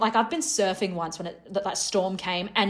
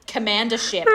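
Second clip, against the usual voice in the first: ordinary talk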